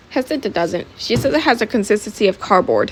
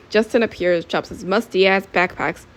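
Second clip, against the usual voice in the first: ordinary delivery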